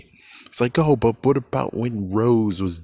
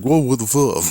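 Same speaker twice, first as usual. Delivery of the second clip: mock shock, louder and higher on "Whoa"; 5 semitones higher, 4 dB louder than usual. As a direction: throw out "Whoa" loud and high in fake shock. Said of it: "It's like".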